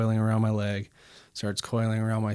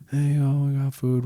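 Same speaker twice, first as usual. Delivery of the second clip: calling out; 4 semitones higher, 4 dB louder than usual. Retold or imitated. Calling out to brothers on couch.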